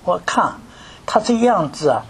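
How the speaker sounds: ordinary talk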